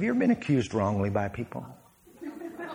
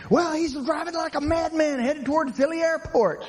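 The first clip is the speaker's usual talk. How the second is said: high, hammed-up outraged complainer; angry driver. Angry churchgoer phoning the pastor about a reckless driver. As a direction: whine it like a scandalized church member.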